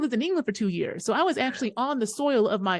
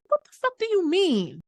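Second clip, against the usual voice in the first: shocked indignation; 9 semitones higher than usual. Retold or imitated. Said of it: "I said".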